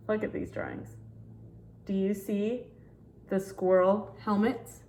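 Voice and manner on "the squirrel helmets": usual speaking voice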